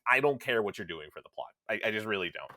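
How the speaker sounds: ordinary delivery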